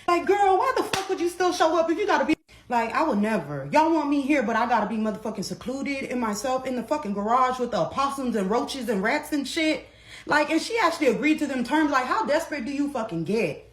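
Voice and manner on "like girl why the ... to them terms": indignant rant. Real, not acted.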